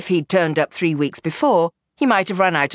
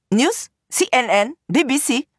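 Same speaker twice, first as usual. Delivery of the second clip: higher-pitched calls, mildly shouted; shouted questions; 7 semitones higher than usual. A narrator's reading. Women to the man filming them, mildly shouted questions. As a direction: call out each guess as a question. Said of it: "They shout at him".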